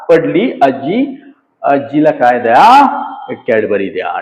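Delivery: a lightly hammed shayari recital; recited with style. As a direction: declaim it like a grand couplet. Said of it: "थोड़ा style से".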